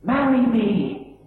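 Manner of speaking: rising, urgent plea; pleading proposal. Acted. Man begging Eileen to marry him, pleading. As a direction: beg her over and over to marry.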